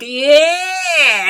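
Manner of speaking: a loud, high whoop; whooping yeah. Retold or imitated. Lestat lounging on his car, whooping excitedly. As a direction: cheer like you're lounging on your new car. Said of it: "he's, like, laying on it".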